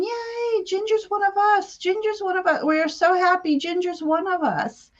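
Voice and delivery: high, light, gleeful chant of tiny folk; cheering fairy voices. Retold or imitated. Fairies welcoming Ginger during a healing, high gleeful cheer. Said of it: "And they were going".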